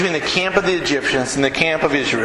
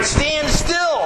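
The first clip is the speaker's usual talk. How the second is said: commanding shout; 7 semitones higher than usual. Acted. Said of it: "Moses said to the people".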